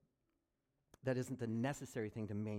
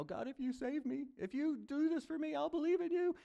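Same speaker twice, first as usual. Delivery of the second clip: very high, strained bargaining prayer; foxhole prayer; 13 semitones higher, 4 dB louder than usual. Retold or imitated. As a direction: bargain with God in a panic. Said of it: "foxhole kind of faith".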